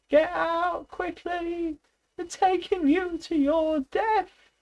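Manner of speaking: very high, slow desperate cry; terrible voice. A narrator's reading. Clover warning Boxer he is going to his death, terrified cry. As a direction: scream the warning with terror. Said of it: "cried Clover in a terrible voice".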